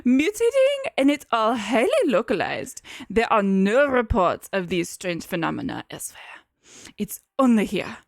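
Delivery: put-on German accent for a jerk scientist. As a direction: play the doctor with a hammed-up German accent. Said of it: "That's German".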